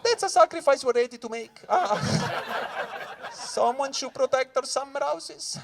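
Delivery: high, slow, smug captain; captain's voice. Retold or imitated. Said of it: "It's like".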